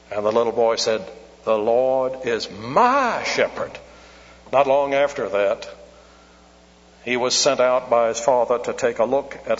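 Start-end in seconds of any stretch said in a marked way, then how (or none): 1.4-3.7 pupil's careful reading, big lift on "my"; stress on my. Retold or imitated. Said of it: "Put the emphasis where it ought".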